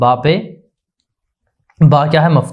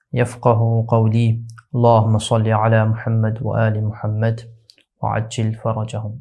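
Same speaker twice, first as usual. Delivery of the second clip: solemn, measured recitation before the lesson; prayer recitation; 5 semitones lower, 5 dB quieter than usual. Acted. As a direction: recite the Arabic opening prayer, low and devout. Opening Arabic prayer recitation.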